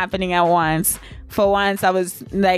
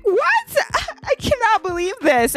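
much higher shriek of disbelief; shocked; 13 semitones higher than usual. Retold or imitated. Told she's six centimeters, high and shocked. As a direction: cry out in utter shock. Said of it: "I'm like".

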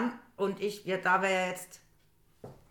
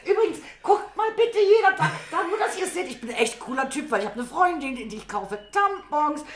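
higher-pitched, proudly hammed-up young man; show-off boyfriend; 9 semitones higher, 3 dB louder than usual. Boyfriend showing everyone at the till what he buys, hammed-up show-off. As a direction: play him announcing it to everyone, laughing. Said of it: "am liebsten wahrscheinlich jedem gezeigt".

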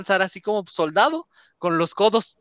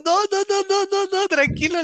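high, rushed pleading to calm him; high nervous "calm down" to a terrified little brother; frantic reassuring; 13 semitones higher than usual. Retold or imitated. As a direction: soothe him in a high panicky voice. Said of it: "Y yo".

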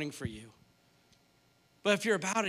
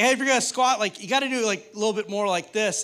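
higher, louder smug tips from the couch; know-it-all coaching; 4 semitones higher, 7 dB louder than usual. Retold or imitated. Husband coaching his yoga-teacher wife, loud and eager. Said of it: "And I was like".

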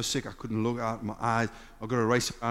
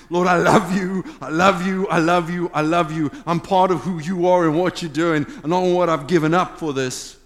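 fervent love declaration; 3 semitones higher, 6 dB louder than usual. Real, not acted. Worshipful declaration to God.

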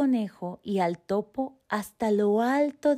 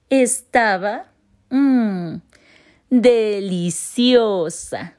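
savouring; 3 semitones higher, 7 dB louder, much slower than usual. A narrator's reading. Narrator savours eating the moon.

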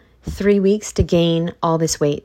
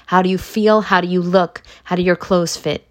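normal talking voice

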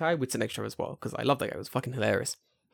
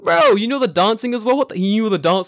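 raised, louder mock astonishment; incredulous outburst, pitched up; amazed; 4 semitones higher, 6 dB louder than usual. Friend finding out coworker knows idol dances, amazed.

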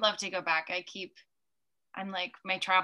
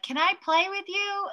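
much higher, gentle asking; sweet invitation; 11 semitones higher than usual. Retold or imitated. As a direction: coax a wary little girl. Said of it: "I'm like".

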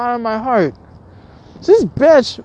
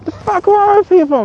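exasperated high; 8 semitones higher, 3 dB louder than usual. Real, not acted. Exasperated rant.

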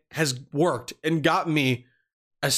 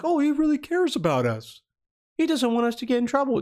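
higher, sing-song mock innocence; mock naive voice; 9 semitones higher than usual. Mocking his younger self's belief in the boss, mock naive. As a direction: parody your own gullible younger self.

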